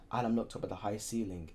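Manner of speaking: usual speaking voice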